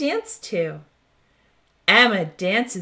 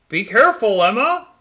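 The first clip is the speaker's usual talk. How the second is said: louder warning called across the field; calling out; 6 dB louder, faster than usual. Acted. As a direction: call out to warn her. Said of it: "calls Simon".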